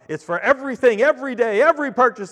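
loud, high pitchman delivery; imitating a booming credit card commercial; Samuel L. Jackson ad. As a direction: sell it like a booming TV spokesman. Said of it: "that Samuel L. Jackson commercial".

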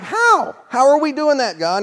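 very high incredulous cry; mock the innocent plea, squeaking high; incredulous how. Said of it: "they're like".

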